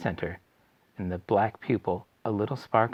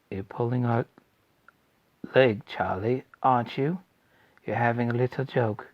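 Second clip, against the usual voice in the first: plain reading voice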